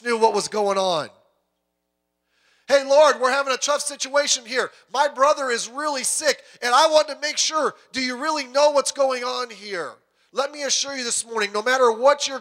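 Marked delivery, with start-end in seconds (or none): 2.7-9.9 loud, raised-pitch plea for help; pleading call to Jesus. Acted. Martha and Mary calling out to Jesus, loud and urgent. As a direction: cry out to the Lord with urgency. Sisters message Jesus about brother.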